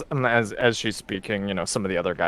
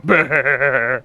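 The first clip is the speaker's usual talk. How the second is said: gruff crowd grunt; 3 semitones higher, 5 dB louder than usual. Acted. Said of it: "you hear all the other guys go".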